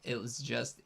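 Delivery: usual speaking voice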